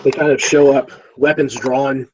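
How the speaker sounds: ordinary talk, nothing marked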